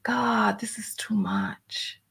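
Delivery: soft, slow whisper, nearly giving up; defeated whisper, worn out; overwhelmed whisper. Real, not acted. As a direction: let it slip out under your breath. Overwhelmed by Facebook trouble.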